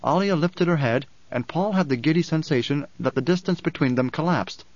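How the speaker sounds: ordinary delivery, nothing marked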